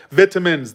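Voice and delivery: "vitamins" with short i, VIT-uh-mins; British pronunciation. Shown as a demonstration. Agreeing on the British word, "vitamins" as VIT-uh-mins. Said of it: "They say vitamins".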